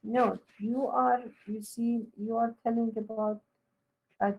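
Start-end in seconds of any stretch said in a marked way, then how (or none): none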